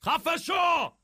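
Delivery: high, loud outburst; furious shout. Acted. Oswald silencing his scolder, furious shout. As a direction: scream it to silence them. Quarrel, shut up.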